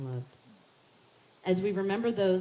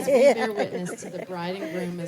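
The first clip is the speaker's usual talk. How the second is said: laughing through the line; louder, laughing along; 12 dB louder than usual. Real, not acted. Laughing crowd, officiant laughing.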